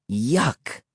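slightly softened grumble of distaste; muttered disgust. A narrator's reading. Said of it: "Emily muttered".

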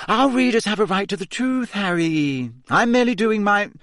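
high, prim journalist tone; smooth, self-righteous explaining; smug reporter voice. A narrator's reading. Rita's smooth reply.

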